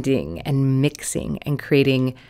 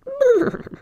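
sudden high-pitched cackle; high squeaky laugh. Pretend. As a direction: let out a squeaky giggle. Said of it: "I had to do that one".

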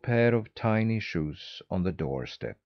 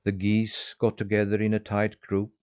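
plain reading voice

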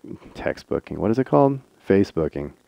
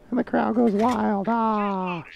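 mock crowd cheering; 13 semitones higher, slower than usual. Mock crowd cheer after win.

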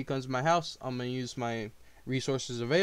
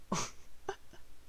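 breathy laugh; 5 semitones lower, 15 dB quieter than usual. Real, not acted. Laughs after outrage.

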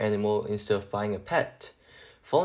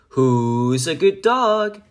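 slow, louder sing-song dog praise; 4 semitones higher, 7 dB louder, much slower than usual. Acted. Arfie cooing praise at himself, slow sing-song. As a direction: croon it the way owners coo at a pet.

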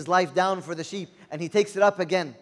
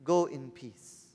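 "Go in peace" said slowly and gently, each word drawn out; a priest's slow, low dismissal, "Go in peace"; slow blessing; 5 semitones lower, 4 dB quieter, much slower than usual. Shown as a demonstration. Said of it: "Then what does he say?".

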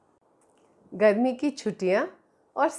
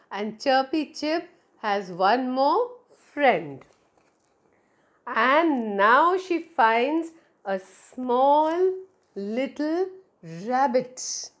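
usual speaking voice

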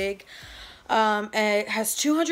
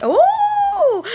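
high sung ooh; 10 dB louder than usual. Acted. High squeal in the song.

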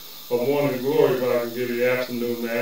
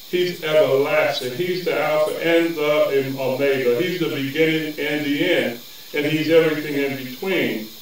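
normal talking voice

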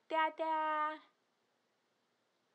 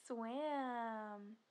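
ordinary talk

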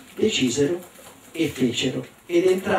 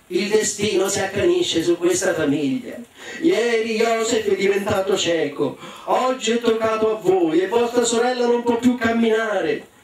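proclama che sale di tono; madre teatrale; 6 semitones higher than usual. Acted. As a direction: madre che arringa i figli con finta tragedia. (madre declamatoria, sempre più acuta)

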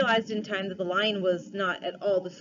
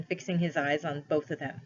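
plain reading voice

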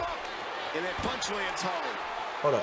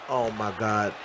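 normal talking voice

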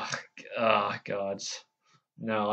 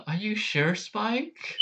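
Twilight impression; 10 semitones higher, slower than usual. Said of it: "let's start with Twilight here".

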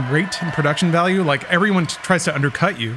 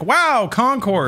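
higher, very slow wowed exclamation; mock awe; 5 semitones higher, much slower than usual. Host playing a viewer reaching the Concord episode, mock awe. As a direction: stretch it out like an easily dazzled fan. Acting a viewer won over.

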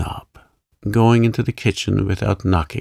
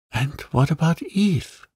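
high-pitched, helpless wondering; a bewildered thought, pitched well up; 8 semitones higher than usual. A narrator's reading. Visitor silently wonders about Eve on meeting Molly, helpless. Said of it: "he thought helplessly".

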